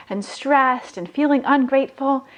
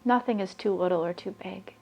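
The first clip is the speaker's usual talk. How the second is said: guided meditation; 9 dB quieter than usual. Acted. [name] guiding listeners to raise their vibration, soft meditation voice. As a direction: breathe the words out calmly, leaving long pauses.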